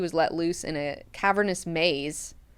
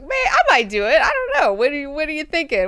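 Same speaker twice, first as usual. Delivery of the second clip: much higher, loud, fast and giddy; mock eager; 10 semitones higher, 6 dB louder, faster than usual. [name] jokes about the suggestion, giddy and high. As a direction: blurt it out half-joking.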